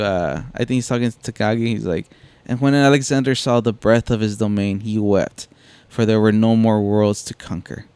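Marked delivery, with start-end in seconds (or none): none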